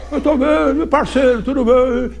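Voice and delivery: urubu malandro, agudo; voz de urubu camarada. Retold or imitated. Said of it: "E imitou". (padre faz o urubu responder, voz aguda)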